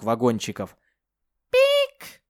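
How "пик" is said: very high synthetic beep; electronic beep. Acted. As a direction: beep like a machine. Chip beep.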